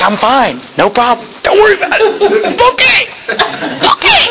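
rising into a very high squeak; strained squeak. Retold or imitated. Boy reassuring everyone while burning, climbing into a squeak. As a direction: pretend all is well as the heat builds. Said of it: "But he's like".

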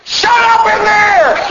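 high, drawn-out shout across the room; hammed-up lazy parent's holler; yelling parent. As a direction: yell it at a kid from your chair. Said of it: "just yell".

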